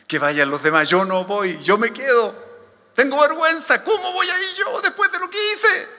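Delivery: refusal rising to a high, loud protest; acted shame. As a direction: climb from refusing to pleading in shame. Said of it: "alguno de ustedes se sintió así". (vergüenza actuada, subiendo a protesta aguda)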